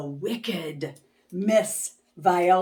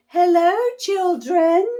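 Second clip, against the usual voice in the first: much higher, gentle greeting; sweet small voice; 11 semitones higher, 7 dB louder than usual. Acted. Miss Nelson returning to her class, high and sweet. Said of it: "said a sweet, small voice".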